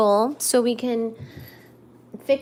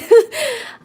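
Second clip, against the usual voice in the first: laugh; 7 dB louder than usual. Real, not acted. Laughing after table shifted.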